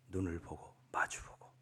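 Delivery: whispered, tender ending. Real, not acted. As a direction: hush it as the moving close. 감동적 결말. (감동적인 결말을 속삭이듯)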